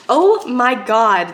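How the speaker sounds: loud, slow exclamation of wonder; real astonished joy; awed delight. Real, not acted. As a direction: draw it out, thrilled and amazed. Unwraps a longed-for book.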